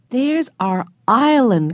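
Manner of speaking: put-on a boy spotting his island; excited cry. A narrator's reading. Henry to family in the boat, a slow, high excited cry. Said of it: "cried Henry".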